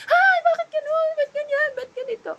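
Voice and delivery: high, loud, near-crying whine of self-pity; mock whiny complaint. Preacher mocks a complainer whose plans failed, high crying whine. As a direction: wail like someone sulking about bad luck.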